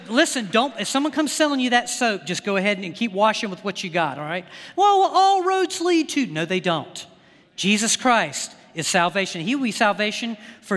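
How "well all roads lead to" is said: a hint of a smug skeptic, higher and slower; mock objector. Preacher mimicking someone selling a false gospel: lightly mocking quote. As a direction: lightly voice the naive claim before cutting it off.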